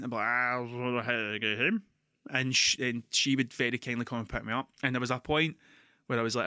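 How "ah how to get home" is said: put-on lower, slurred-sounding drunk plea; drunk phone call. Calling his girlfriend from a gig, a lower slurry drunk voice. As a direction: ask for a lift home, clearly steaming. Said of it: "I'd phone steaming".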